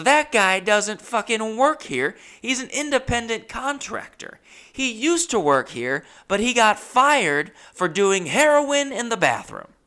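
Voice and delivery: high, loud, slow revelation; emphatic boss. Retold or imitated. Boss revealing the tattooist is no employee, loudly emphatic.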